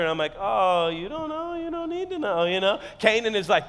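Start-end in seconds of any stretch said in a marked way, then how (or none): 0.4-2.8 joking back. Retold or imitated. Husband joking back with wife, coy and teasing. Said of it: "So I joke back with her".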